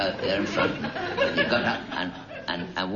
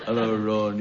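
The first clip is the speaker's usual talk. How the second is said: producer impression. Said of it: "that voice is a very lifelike impersonation".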